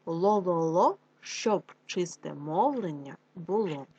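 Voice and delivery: slow, syllable by syllable; slow careful tongue-drill reading; slow articulation drill. Shown as a demonstration. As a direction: spell each sound out slowly for the kids. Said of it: "Прочитаємо з вами правильно".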